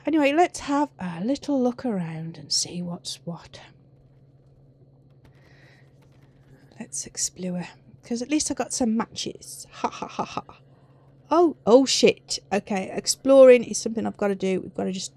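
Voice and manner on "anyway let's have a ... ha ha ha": breathy whisper. Real, not acted. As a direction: keep it to a whisper. Whispering in scary game.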